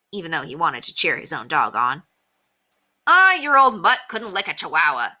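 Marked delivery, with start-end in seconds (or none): 3.1-5.1 loud, higher-pitched scoff. A narrator's reading. Scooter belittles Henry's dog, scoffing. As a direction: dismiss the dog with loud scorn. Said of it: "scoffed Scooter".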